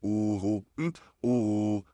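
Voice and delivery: ऊ with lips in a full round circle; ऊ, fully rounded lips; rounded oo demo. Shown as a demonstration. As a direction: push the lips forward into ऊ. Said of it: "full round circle किस तरीके से".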